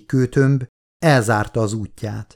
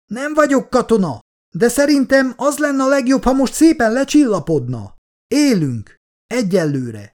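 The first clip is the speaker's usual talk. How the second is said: calming professor; 11 semitones higher than usual. Acted. Professor calms the soldier.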